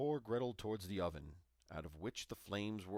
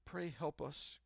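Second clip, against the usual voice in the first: tearful prayer; 6 semitones higher, slower than usual. A narrator's reading. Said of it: "cried she".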